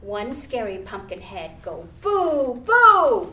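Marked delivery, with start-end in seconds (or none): 2.0-3.3 spooky boo, high and loud; spring the boo on the kids. Acted. Scary pumpkin head goes boo.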